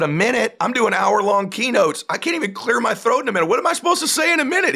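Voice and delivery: high, climbing mock indignation; incredulous protest. Keynote speaker told to keep it under a minute, incredulous. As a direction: build from disbelief into a high-pitched rant.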